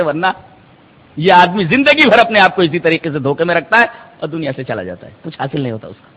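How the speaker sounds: ordinary talk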